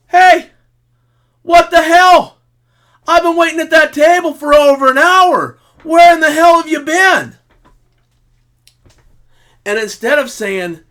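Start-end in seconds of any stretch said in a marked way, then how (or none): 0.1-7.4 shouting full voice, much higher and louder; furious yell. Shown as a demonstration. Man kept waiting an hour yells at his partner across the room, furious shout. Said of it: "you go".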